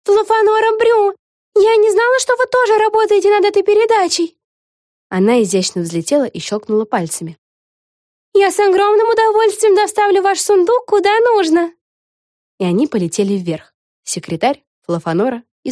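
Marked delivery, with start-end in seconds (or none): 0.0-4.4 high fawning squeal; squealing excitedly. A narrator's reading. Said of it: "заверещала". 8.3-11.7 high, eager to please; fawning witch. A narrator's reading. Fawning witch offers help.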